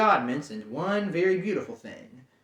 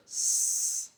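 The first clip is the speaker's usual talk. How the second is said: soft snake hiss; 5 dB quieter than usual. Acted. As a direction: hiss through the teeth. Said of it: "hissed Gollum".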